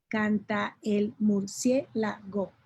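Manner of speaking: canta el murciélago drawn out, with canta and el merged; deliberate verse-counting read; slow syllable count. Shown as a demonstration. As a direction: count it out slowly. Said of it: "pero hay sinalefa".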